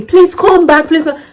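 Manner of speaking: a mild high call, pleading him back; calling after. Retold or imitated. Man running after her calls her back, mildly high and pleading.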